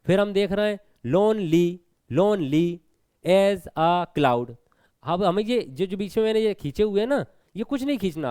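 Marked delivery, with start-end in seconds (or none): 1.1-1.8 lonely pulled apart as lone, then ly; broken into syllables. Shown as a demonstration. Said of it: "दो अक्षरों को अलग अलग तोड़ लो". 2.1-4.5 lonely as a cloud in slow separate beats; slow, spaced-out lonely as a cloud; syllable by syllable. Shown as a demonstration. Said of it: "दो अक्षरों को अलग अलग तोड़ लो".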